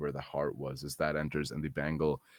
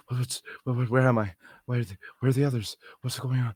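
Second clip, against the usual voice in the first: panicked confusion; 6 semitones higher, 3 dB louder, much faster than usual. Acted. Character wakes up confused, frightened.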